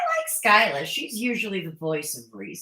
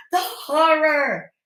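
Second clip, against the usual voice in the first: slow, higher, louder mock-dramatic; sarcastic mock horror; 8 semitones higher, 5 dB louder, slower than usual. Vlogger ridiculing Angela's hypocrisy, slow sarcastic mock horror. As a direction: drag it out with theatrical sarcasm.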